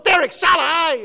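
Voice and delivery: loud call upstairs; father calling son to come down, loud. Retold or imitated. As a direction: shout down the hall to the son. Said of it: "我再叫，声音再大一点".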